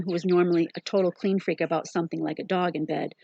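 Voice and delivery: ordinary delivery, nothing marked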